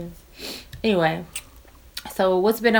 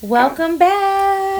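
excited greeting; 13 semitones higher, 5 dB louder, much slower than usual. Acted. Greeting listeners, sung out.

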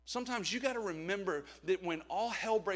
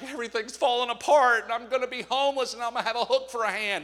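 loud, higher-pitched catastrophizing; mock panic; 6 semitones higher, 12 dB louder than usual. Preacher acts out someone in a death spiral, loud mock panic. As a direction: wail it out in exaggerated panic.

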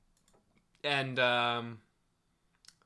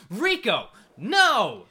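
higher and louder, pleading no; mock anguished plea; 8 semitones higher, 9 dB louder, much slower than usual. Viewer yelling at Rico on screen, loud plea. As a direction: cry out to stop him. Pleading with character while watching.

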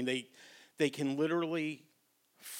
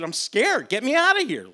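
frightened frog; 12 semitones higher, 13 dB louder than usual. Retold or imitated.

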